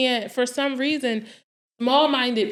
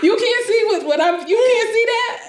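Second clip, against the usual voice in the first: very high, laughing disbelief; mock incredulity; 13 semitones higher, 5 dB louder than usual. Dreamer to a doubter, high-pitched mock disbelief. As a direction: question them as if it's absurd.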